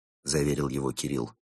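ordinary delivery, nothing marked

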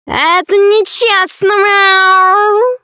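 high, drawn-out yowling cat voice; protesting cat cry. A narrator's reading. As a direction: wail in protest, stretching into a meow. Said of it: "закричал вслед кот". (обиженный кошачий вопль)

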